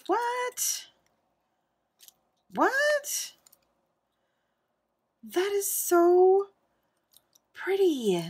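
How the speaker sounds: high, loud, stretched disbelief; shocked squeal. Real, not acted. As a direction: shriek it like you can't believe it. Stunned by a gift.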